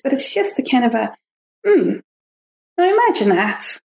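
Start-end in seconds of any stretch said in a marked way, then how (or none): 1.7-3.8 pitched-up, musing wonder; gently intrigued inner observer; soft, gentle voice. Acted. Inner voice comments on what it notices, soft gentle voice. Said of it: "it's a soft, gentle voice".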